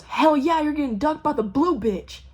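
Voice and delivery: high, loud, triumphant holler; rowdy whoop. Retold or imitated. Stranger from the bar ducking their Jeep, rowdy whoop.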